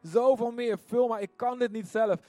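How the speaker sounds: preacher leading the church in a passionate prayer; fervent prayer. Acted. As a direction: pour it out to God, building to a cry.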